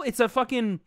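ordinary talk

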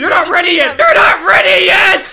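repeated warning rising to a high shout; mock warning, building. Player warning friend off a secret, building mock shout. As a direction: build from laughing warning to full yell.

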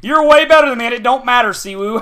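sarcastic impression of a taunting winner; taunting opponent. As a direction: mimic a smug rival rubbing it in.

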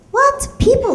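sharp high outraged what; mock-offended high what; indignant what. Real, not acted. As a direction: squeak it in disbelief. Offended by people like you.

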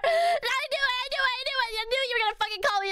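high, whiny protest; flustered whine. Real, not acted. Caught by chat's joke, high flustered whine. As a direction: wail it in embarrassed protest. Flustered at chat calling her names.

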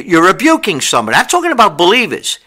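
ordinary delivery

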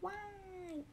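lightly voiced high cheer; a thin, high little yay; mimicking a cheer. Imitating a member's happy cheer, high and soft. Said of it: "嬉しい時って真似するよ".

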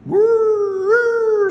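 very high, loud siren wail; piercing wailed sound effect; shrieking siren. A narrator's reading. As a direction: imitate a police siren at the top of the voice. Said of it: "sirens shrieked".

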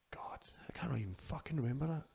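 breathy whisper to himself, half-frustrated; whispered aside. Real, not acted. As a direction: whisper it as if talking to yourself.

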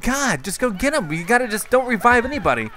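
high-pitched, rapid-fire pleading with teammates; frantic urging. Real, not acted. Downed gamer telling Crisis Swag to get him, frantic urging. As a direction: yell urgent orders in a real panic.

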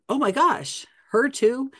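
high-pitched mock amazement; hammed-up surprised disbelief; acted astonishment. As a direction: exclaim it as a startled reaction, pitch jumping up. Imagined Poirot reacting to the news.

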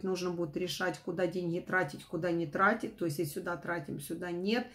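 ordinary talk

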